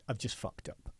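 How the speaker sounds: breathy near-whisper, ashamed and small; retelling a sheepish late-night confession; hushed confession. Retold or imitated. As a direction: whisper it like a guilty admission. Client confessing down the phone.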